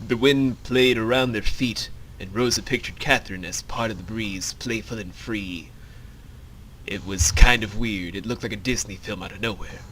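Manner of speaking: low, even storyteller's voice; low-pitched narration; story narration. A narrator's reading. Reads the script's narration.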